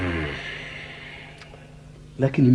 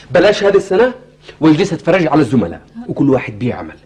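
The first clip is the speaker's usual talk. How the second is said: complaining loudly; 5 semitones higher, 6 dB louder than usual. Acted. Husband complains of idleness.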